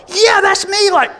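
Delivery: high, loud pumped-up volunteer; fired-up kid asking to join the charge; eager shout. Retold or imitated. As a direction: beg to be taken along, fired up. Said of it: "you're like".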